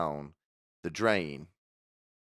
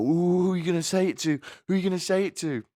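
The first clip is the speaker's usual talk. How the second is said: gossipy excitement; 11 semitones higher, faster than usual. Retold or imitated. Teachers in the staff room asking eagerly, a high giddy squeal. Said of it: "And all the teachers go".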